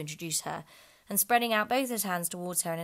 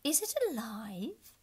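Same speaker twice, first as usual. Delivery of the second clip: slow, higher curious unicorn; 6 semitones higher, slower than usual. A narrator's reading. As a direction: wonder aloud. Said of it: "said the unicorn".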